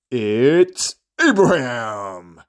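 slow, drawn-out hype call; big arena name reveal; ring announcer intro. Pretend. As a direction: stretch the name like a boxing announcer. Wrestling-style entrance announcement.

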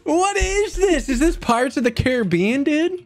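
very high, wailing protest; exasperated outcry. Real, not acted. Stranded in the ocean by friend, exasperated high outcry. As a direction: cry out in high-pitched disbelief.

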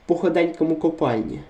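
normal talking voice

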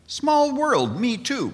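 high, bright jump of pitch on "Small world, me too"; eager me too. Retold or imitated. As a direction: chirp it up like an overeager believer. Joke, speaker's eager reply.

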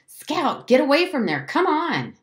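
high, loud urgent call; urgent screaming. A narrator's reading. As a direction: call out in alarm from a distance. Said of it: "Jem was screaming".

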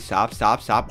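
ordinary talk, nothing marked

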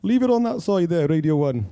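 put-on Cardiff accent. Telling the driver about the radio in a Cardiff accent. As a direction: imitate a mate's very Cardiff accent. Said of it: "in his very Cardiff accent".

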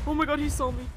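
loud, high alarmed shout; gasp, then yell in alarm; panicked cry. Real, not acted. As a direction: shout in sudden panic at being spotted. Spotted by the monster.

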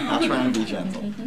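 gentle promise through a laugh. Real, not acted. Teacher joking to class, laughing. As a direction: chuckle as you say it.

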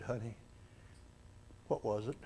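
groggy mother asking her husband; drowsy murmur. Retold or imitated. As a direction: barely lift the voice out of sleep. Mother waking from anesthetic.

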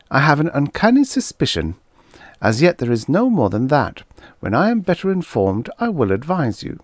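put-on high, prim child voice; a solemn eight-year-old, pitched high; precocious little girl. Girl answering the policeman, high and prim.

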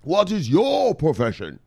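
high, slow, drawn-out royal summons; kingly call. Retold or imitated. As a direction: call out grandly to a soldier. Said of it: "And then he said".

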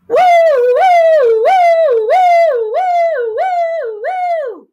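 high, loud wailing "woo"; sung siren swoops, fading gradually; fire truck siren. Shown as a demonstration. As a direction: wail like a fire engine. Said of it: "use my singing voice to sound like the siren".

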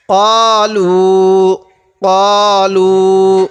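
both vowels of "কলু" drawn out slowly; one-alif stretch. Shown as a demonstration. Teacher demonstrating madd, both syllables of "কলু" stretched. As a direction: stretch "ক" and "লু" one count each. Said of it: "এই কফের মধ্যেও এক আলিফ টান".

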